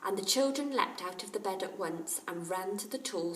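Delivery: ordinary delivery, nothing marked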